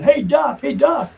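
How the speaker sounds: high, loud imitated hail; calling out. Man runs up to the fence hailing the doc, high call. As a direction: call for attention across a yard.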